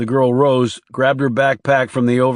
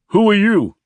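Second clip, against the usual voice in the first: high, raised call; calling after; 9 semitones higher, 4 dB louder than usual. A narrator's reading. Driver to the departing man, calling after him. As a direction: call it out after someone leaving. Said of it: "the driver called after Roby".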